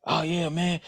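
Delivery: normal talking voice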